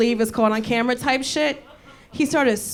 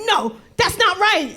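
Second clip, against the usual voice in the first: put-on higher, slow Dutch-accented telling-off; scolding Dutch accent; 5 semitones higher than usual. Dutch husband telling off a stranger, scolding in Dutch accent. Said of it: "like a teacher's yelling at you".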